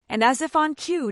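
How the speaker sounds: ordinary delivery, nothing marked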